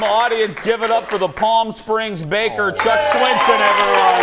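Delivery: loud, high showy introduction; announcer introduction. Real, not acted. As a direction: announce the guest to the crowd. Host introduces guest to audience.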